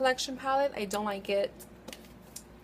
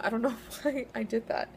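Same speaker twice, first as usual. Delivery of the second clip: normal talking voice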